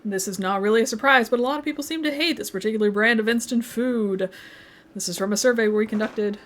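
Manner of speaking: put-on TV news delivery; newscaster voice. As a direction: read it out like a newscaster. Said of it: "Should I do this in the newscaster voice again?".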